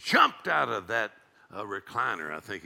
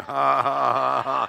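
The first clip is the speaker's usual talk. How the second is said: loud, deliberate ha-ha-ha; triumphant laughing; 6 dB louder, slower than usual. Pretend. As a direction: laugh back heartily on purpose. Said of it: "I'm laughing all the way to the bank".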